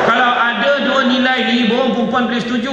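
high, loud rallying call; loud call to women. Real, not acted. The preacher calling out to the women, high and loud. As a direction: rouse the women in the crowd. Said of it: "saya tanya perempuan".

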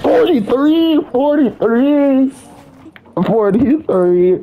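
high, slow shrieking; excited shriek. Real, not acted. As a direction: squeal the number out. Shrieking damage number.

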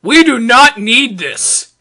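ordinary delivery

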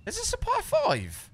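surprised. Real, not acted. Disbelief at short par five.

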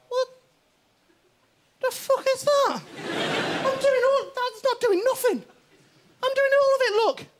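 high, loud, indignant cartoon voice; the fed-up organ ranting at its lazy neighbour; shrill indignant kidney rant; outraged kidney. Right kidney voiced complaining.

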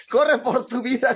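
laughing. Real, not acted. Laughing line.

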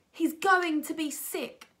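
put-on girl warning the teacher; alarmed girl. A narrator's reading. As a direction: warn the teacher in a panic. Girl alarmed about sick boy.

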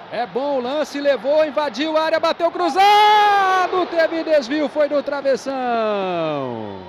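building roar to the crossbar; rising yell, then the miss; excited shout. Real, not acted. Shot hits crossbar. (narração empolgada)

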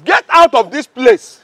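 slow, loud barked order; angry shout. Acted. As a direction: throw them out with a furious yell. Guard ordering visitors away.

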